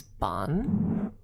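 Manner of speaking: "ban" with an open ah, like "bahn"; Gaelic pronunciation. Shown as a demonstration. Streamer showing viewers how B E A N sounds, Gaelic pronunciation. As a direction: say "ban" as Gaelic bean, rhyming with John. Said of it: "B E A N is ban".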